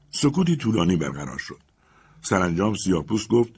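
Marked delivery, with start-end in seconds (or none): none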